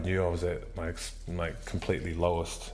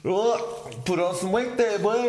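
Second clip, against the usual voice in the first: very high mock mirror voice; hammed-up high-pitched self-criticism; old critical self; 3 dB louder than usual. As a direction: jeer at your reflection in a squeaky put-on voice. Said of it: "the old dude creeps back in".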